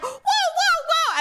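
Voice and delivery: very high, drawn-out startled whoas; alarmed protest. Retold or imitated. Wife jolted awake by grabbing husband, alarmed protest. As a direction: re-enact a startled cry, high and slow. Retelling being grabbed in sleep.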